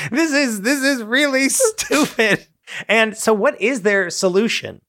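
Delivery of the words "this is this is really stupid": high, slow, fed up; mock exasperated voice. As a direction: squeak out how dumb this is. Acting the villagers' disbelief.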